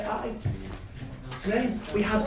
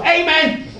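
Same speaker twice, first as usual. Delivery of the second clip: loud amen; 10 semitones higher, 8 dB louder than usual. Retold or imitated. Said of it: "Kenny would go".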